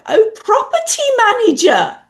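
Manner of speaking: high, loud penny-drop exclamation; imitating listeners suddenly catching on; sudden realisation. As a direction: blurt it out as it finally clicks. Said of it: "when the context went".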